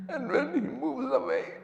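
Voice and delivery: voice breaking. Real, not acted. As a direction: let the voice crack and climb. Voice cracks telling of Zainab.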